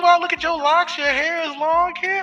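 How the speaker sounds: high, put-on gushing voice; mock flirty admirer. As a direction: fawn in an exaggerated high pitch, stretching the last greeting. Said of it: "they'll come like this".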